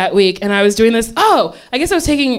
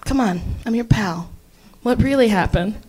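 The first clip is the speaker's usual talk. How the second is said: low, soft knowing pal; soft coaxing; 3 semitones lower, 5 dB quieter than usual. Retold or imitated. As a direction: gently coax a confession. Dad's friend coaxing the truth.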